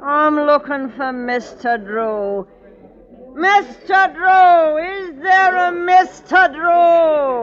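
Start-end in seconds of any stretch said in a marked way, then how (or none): 3.3-7.4 high, loud, tearful call across the pub; desperate calling out. Acted. Gertie calls across the pub for Mister Drew, tearful shout. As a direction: cry out the name, close to tears.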